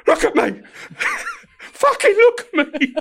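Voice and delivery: very high, loud, slow, cracking up; imitating an angry prisoner through laughter; laughing mock outrage. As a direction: try to act furious but keep laughing. Angry prisoner confronting him, teller laughing.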